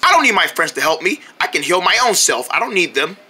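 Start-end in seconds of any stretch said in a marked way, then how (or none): none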